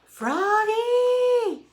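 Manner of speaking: very high, loud drawn-out yell. A narrator's reading. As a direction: holler the name as long and high as you can. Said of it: "yelled his dad".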